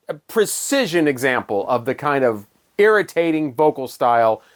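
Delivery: ordinary talk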